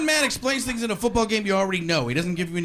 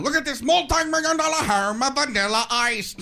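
Robin Leach; 6 semitones higher than usual. Retold or imitated. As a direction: ham it up like a gossip host. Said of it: "Robin Leach isn't laughing".

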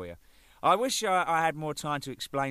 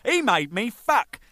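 louder and slow, each word weighted; slow, loud, exasperated; slow exasperation; 10 semitones higher, 8 dB louder, slower than usual. Real, not acted. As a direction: drag it out in disbelief. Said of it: "Jesus Christ".

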